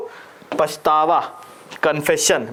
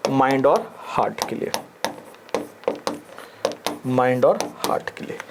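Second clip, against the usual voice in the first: soft, low chorus of students answering; low mock class reply to the teacher; 4 semitones lower, 5 dB quieter than usual. Shown as a demonstration. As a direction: answer like the class repeating back. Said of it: "किसके लिए होता है भैया".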